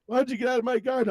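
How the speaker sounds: high, fast mock outrage; angry old man. Old man ordering Cartman away, mock anger. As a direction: snappily order them out in cartoon pitch. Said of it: "the old man".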